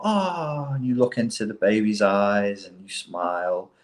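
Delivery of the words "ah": much higher coo; high doting ah; higher baby voice. Shown as a demonstration. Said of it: "raise your voice a little bit higher".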